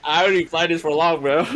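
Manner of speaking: fast, high gleeful boast; excited boast. Real, not acted. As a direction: brag it out, grinning.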